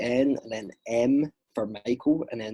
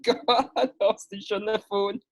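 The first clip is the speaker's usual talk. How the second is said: lightly voiced copper on the phone, pitched up high; officer ringing Mister Rodgers, a pitched-up mock formal voice; mock police officer; 9 semitones higher than usual. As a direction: play a cop phoning the football manager. Imagined police phone call.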